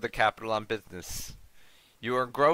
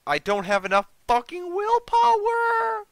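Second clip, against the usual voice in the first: very high, loud wail of dismay; frustrated outburst; 7 dB louder than usual. Real, not acted. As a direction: wail in exasperation, stretching the end. Player frustrated at game stat.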